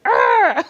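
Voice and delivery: put-on a child's high copied "arr"; nephew copying growl. Little nephew copying his aunt, a high playful growl. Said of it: "He was like".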